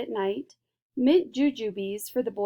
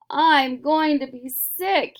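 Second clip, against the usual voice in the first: higher, louder and slow, queasy; sick yell; 5 semitones higher, 7 dB louder, slower than usual. A narrator's reading. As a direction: groan it out, about to be sick. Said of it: "yelled Missus T V".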